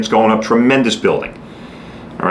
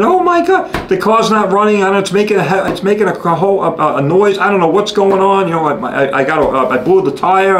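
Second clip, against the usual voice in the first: high, frantic imitation of a flustered wife; mock a panicking wife; panicked wife; 7 semitones higher than usual. Husband imitating his frantic wife about the car. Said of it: "your wife comes screaming".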